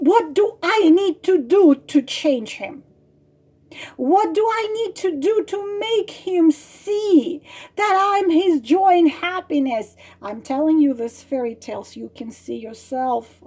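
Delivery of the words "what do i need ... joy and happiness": high, plaintive client impression; mock pleading, voice pushed up; imitated desperate plea. Clients ask how to change him, mock desperate.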